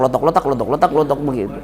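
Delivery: soft mock kelotok of old knees; knee clicking sound. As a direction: click out the knee noise with a laugh. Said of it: "dengkul itu udah kelotok".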